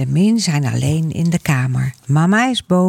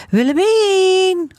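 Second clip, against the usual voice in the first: very high, long-drawn call; loud calling; slower than usual. A narrator's reading. Boy calling upstairs for his sister, long high call. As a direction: stretch the name out high and slow. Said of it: "roept Gijsbert heel hard".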